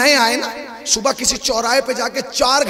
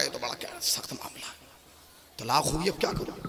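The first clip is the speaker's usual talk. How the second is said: worried murmur; 10 semitones lower, 13 dB quieter than usual. Acted. Worried king talks to himself.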